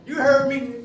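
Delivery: high, loud teasing retort; cocky comeback. Retold or imitated. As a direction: toss it back with swagger. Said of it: "he's like".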